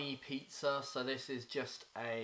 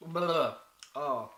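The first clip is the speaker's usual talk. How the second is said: eating sour candy; 7 dB louder than usual. Real, not acted. Right after tasting Warheads sour candy.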